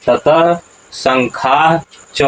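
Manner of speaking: "ततः शंखाश्च" very slow, each syllable distinct, शंखाश्च ending in a breathy h before च; teacher slowly modelling "ततः शंखाश्च" for students; correct recitation. Shown as a demonstration. धीरे-धीरे शुद्ध उच्चारण "ततः शंखाश्च". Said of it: "उच्चारण क्या होगा".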